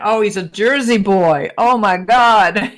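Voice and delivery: thrilled disbelief bursting out; delighted exclamation. Retold or imitated. Hearing his Jersey accent, a delighted loud exclamation. Discovering he's from New Jersey.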